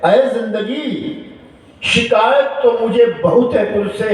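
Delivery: high declaimed verse, drawn-out opening; poetry recital. Acted. Preacher reciting a poet's complaint to life, high declamation. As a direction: call out to life like a poet. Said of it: "किसी शायर ने लिखा है".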